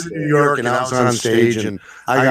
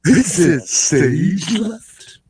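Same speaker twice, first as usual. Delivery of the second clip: high, very slow cartoon drawl; theatrical, pitched-up cartoon character; Snagglepuss; 6 semitones higher, much slower than usual. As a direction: stretch each word out in a high cartoon voice.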